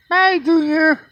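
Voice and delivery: squeaky falsetto farewell; high bird goodbye. Real, not acted. Man saying goodbye to the young bird in falsetto. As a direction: sing it up high and thin to the bird.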